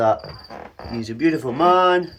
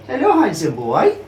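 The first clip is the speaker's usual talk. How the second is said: high, louder cooing hello; high pet voice; 8 semitones higher, 5 dB louder than usual. Real, not acted. Owner greeting python, high excited coo. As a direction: greet a beloved pet with delight.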